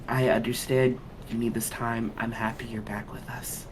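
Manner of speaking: soft, understanding tone; softly spoken and sincere; tender reassurance. Acted. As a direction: gently welcome a companion back, softly. In-character tender words to revived companion.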